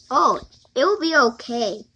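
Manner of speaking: ordinary talk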